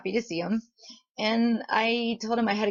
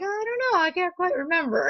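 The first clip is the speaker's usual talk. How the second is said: higher-pitched "dunno" quote; retold unsure reply; 9 semitones higher than usual. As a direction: act out not quite remembering.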